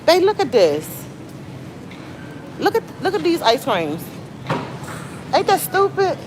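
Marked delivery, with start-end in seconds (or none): 2.6-6.2 amazed. Real, not acted. Amazed at the ice creams.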